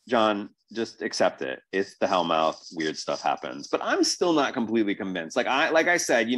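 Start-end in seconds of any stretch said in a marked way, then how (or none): none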